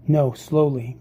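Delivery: plain reading voice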